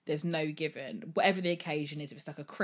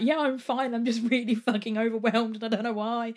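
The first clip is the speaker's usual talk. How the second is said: higher and louder, blurting it out; half-laughing outburst; 6 semitones higher, 8 dB louder than usual. Retold or imitated. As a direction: burst out that you're fine but overwhelmed. Dad asks if she's okay.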